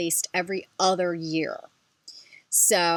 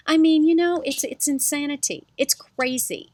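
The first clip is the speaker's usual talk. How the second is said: much higher, louder gush; excited outburst; 8 semitones higher, 6 dB louder than usual. Real, not acted. Confessing her handbag insanity to viewers, excited. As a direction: burst out laughing at your own craziness.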